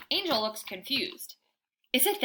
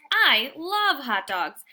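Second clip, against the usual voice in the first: slow, louder, savouring every word; hot dog longing; 3 semitones higher, 7 dB louder, slower than usual. A narrator's reading. Dog narrator drooling over hot dogs, slow and longing.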